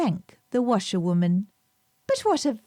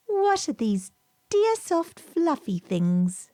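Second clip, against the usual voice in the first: put-on higher, slow little girl; slow wondering child; 6 semitones higher than usual. A narrator's reading. Lucy asking the washerwoman, slow and wondering. Lucy admiring the woolly coats.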